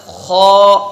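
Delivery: خو as a full, heavy kh with rounded vowel, held loud. Shown as a demonstration. Teacher models the full letter, heavy kh. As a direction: lift the back of the tongue for a heavy خو. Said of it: "زبان کی جڑ کو بلند کریں گے".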